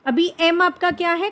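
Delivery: ordinary talk, nothing marked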